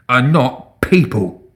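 slow, emphatic denial; indignant, measured rebuttal; roared accusation. A narrator's reading. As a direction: spell it out slowly with contempt. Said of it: "he roared".

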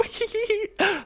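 very high, soft put-on giggle; cutesy giggle. Imagining his flirty line landing, cutesy giggle. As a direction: giggle squeakily, as if being adorable. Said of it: "dachte ich so, es wär cute".

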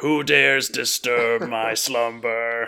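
slow booming undead king; ancient rasping undead king. Pretend. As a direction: rise from the crypt and demand. Playing woken undead king.

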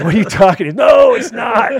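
high, hammed-up shouted protest; indignant denial. Secretive dealer denying it is a solvent, mock indignant shout. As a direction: mimic an outraged man yelling his denial.

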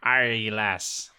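put-on amateur pirate accent; bad pirate accent. Co-host mimicking the movie pirate's hated voice, bad pirate accent. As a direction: do a half-convincing pirate accent. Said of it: "trying to do some sort of accent".

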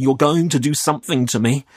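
put-on shaky scared boy; trembling. A narrator's reading. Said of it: "I was still trembling".

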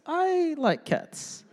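high, slow, drawn-out grudging agreement; reluctant mock reply. Preacher mimics a lover barely agreeing, high and slow. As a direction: pretend to go along, unconvinced.

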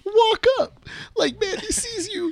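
high-pitched voiced friend; mock friend voice relaying directions; imitating friend.

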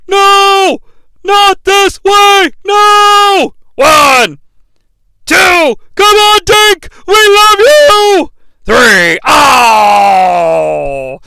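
high, loud pleading yells; desperate fan shouting. Acted. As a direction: beg for your hero to kick out. Desperate fan calling the pin.

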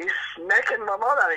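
high, laughing through the words; can't hold back the laugh. Real, not acted. Son telling the pub joke on his dad, laughing.

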